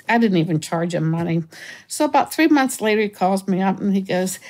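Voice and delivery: ordinary delivery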